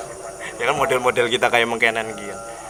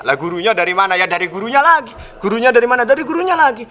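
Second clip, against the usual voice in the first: mock questioning; 9 semitones higher, 3 dB louder than usual. Mock back-and-forth questioning.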